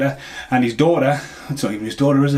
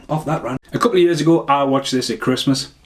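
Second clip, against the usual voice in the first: ordinary delivery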